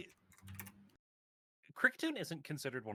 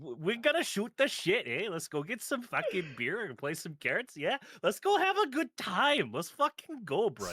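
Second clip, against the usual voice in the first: exaggerated Canadian accent; 6 semitones higher than usual. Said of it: "do the Canadian accent".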